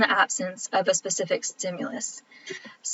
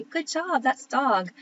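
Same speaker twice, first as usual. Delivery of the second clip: teacher praise; 5 semitones higher, slower than usual. Retold or imitated. Teacher praising child reading.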